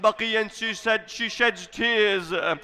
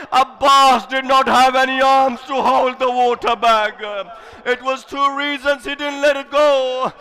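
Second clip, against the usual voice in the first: impassioned preaching; 2 semitones higher, 4 dB louder than usual. Acted. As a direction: cry the tragedy out to the mourners. Majlis preacher at full force.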